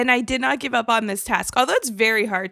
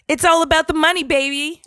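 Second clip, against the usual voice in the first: higher, louder mock swagger; playful pitched-up money catchphrase; hammed-up catchphrase; 6 semitones higher, 5 dB louder, faster than usual. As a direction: announce it like a showy money-lover's slogan. Performs a god nickname as catchphrase.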